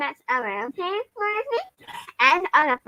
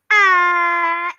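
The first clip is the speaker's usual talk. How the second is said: quoted ah cry; 3 semitones higher, 8 dB louder than usual. Shown as a demonstration. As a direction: show the ah you usually shout. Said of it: "why I've never said".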